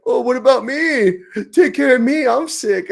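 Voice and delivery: high pleading man flu whine; whiny sick man. Retold or imitated. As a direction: whimper like a pitiful sick husband. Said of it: "You probably made Haley like".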